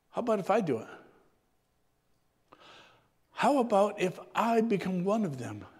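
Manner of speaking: softly voiced, gentle offer; hushed, understated suggestion; very quietly. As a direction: drop the volume and offer it quietly. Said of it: "the Son said very quietly".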